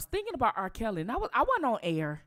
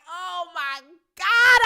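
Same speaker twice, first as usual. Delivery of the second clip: slow, loud, higher gasp of disbelief; stunned oh my God; 8 semitones higher, 10 dB louder, much slower than usual. Retold or imitated. Hearing the twins say his own thought, stunned and loud. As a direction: stretch it out, floored. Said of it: "I was like".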